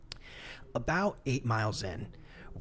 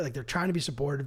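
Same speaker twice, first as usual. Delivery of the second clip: usual speaking voice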